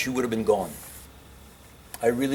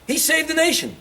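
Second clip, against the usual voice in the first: exasperated insistence. Real, not acted. Defending Lincoln to critics, high indignant insistence. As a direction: say it like it's beyond argument.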